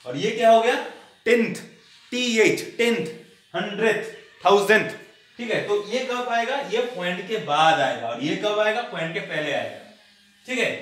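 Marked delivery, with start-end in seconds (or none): 1.2-4.9 loud, separate words, spelling out "टीएच" after "टेंथ"; careful pronunciation. Shown as a demonstration. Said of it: "अच्छे से इसको pronunciation करना सीखो".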